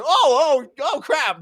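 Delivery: high-pitched panicky dismay; mock alarm. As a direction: exclaim it as if caught off guard, hammed-up. Acted dismayed reaction.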